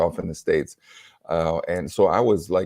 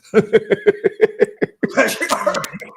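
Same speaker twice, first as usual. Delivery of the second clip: loud high burst of laughter; hearty real laughter; 14 semitones higher, 5 dB louder than usual. Real, not acted. Laughs at wife's reply.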